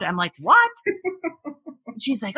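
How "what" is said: high, sharp shocked question; shocked what. Retold or imitated. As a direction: blurt it out in disbelief. Shocked at grandma's story.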